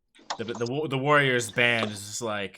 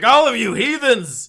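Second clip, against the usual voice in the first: high, loud, slow condemnation; damn the whole room; priest's outraged cry; 10 semitones higher, 9 dB louder than usual. Acted. Priest condemns the jeering tavern, high and loud.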